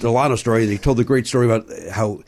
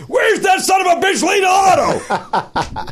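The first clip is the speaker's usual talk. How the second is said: high, drawn-out mock yell; slower than usual. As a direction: bellow it across the stage with a laugh. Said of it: "it's Mel Brooks yelling".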